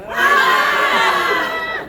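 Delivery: high, loud sustained shout; group yell. Shown as a demonstration. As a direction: yell from the diaphragm. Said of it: "Three, two, one".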